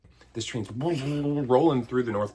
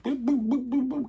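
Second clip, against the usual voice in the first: beeping alarm noise. Retold or imitated. Naughty-kid alarm noises.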